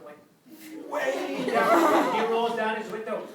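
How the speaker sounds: usual speaking voice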